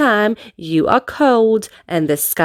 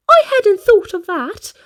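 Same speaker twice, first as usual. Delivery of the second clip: taken aback; 8 semitones higher than usual. A narrator's reading. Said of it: "said Little Miss Naughty".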